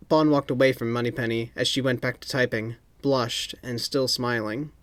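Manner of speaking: ordinary delivery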